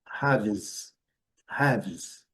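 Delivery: haves ending in a buzzing z; final s as z. Shown as a demonstration. Teacher stressing the plural ending. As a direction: let the s of haves buzz like z. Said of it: "S com som de Z".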